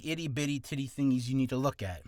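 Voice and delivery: usual speaking voice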